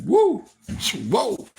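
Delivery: quick high startled whoa. Retold or imitated. Picturing what Hacksaw might do, a startled high whoa. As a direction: jump at the thought.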